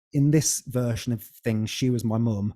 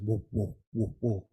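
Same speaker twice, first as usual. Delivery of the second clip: imitating womb noise; 4 dB quieter than usual. Guest imitating the womb sounds played in the tub, softly. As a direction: hum the womb sounds quietly, like water rushing. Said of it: "playing the noise of the womb".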